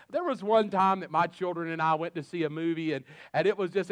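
ordinary delivery

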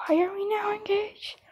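urgent hushed whisper; whispered aside so the others won't hear; panicked whisper. Real, not acted. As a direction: hiss it under your breath in a panic. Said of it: "Because I'm panicking now".

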